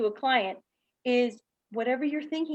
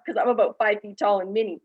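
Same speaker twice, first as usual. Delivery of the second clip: ordinary talk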